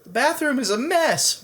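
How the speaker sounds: a fed-up mother moaning; exasperated mom's high moan. Acted. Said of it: "moaned Lewis's mother".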